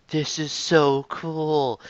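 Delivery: very slow, drawn-out fan amazement; retold awe. Host recalls discovering the series, drawn-out awe. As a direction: stretch it out in wonder.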